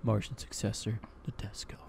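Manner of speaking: hushed, awed imitation, sinking to a whisper; mock reverence. Teasing a friend about his favorite anime, in reverent hush. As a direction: breathe the title like something sacred. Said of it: "hear the reverence in your voice".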